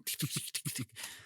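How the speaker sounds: rapid voiceless skittering clicks; skittering noise. Retold or imitated. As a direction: imitate tiny legs scuttling up a wall.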